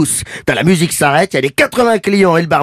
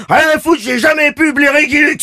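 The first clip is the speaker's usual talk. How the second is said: angry barman; 10 semitones higher than usual. Retold or imitated. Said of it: "Le mec claque la porte".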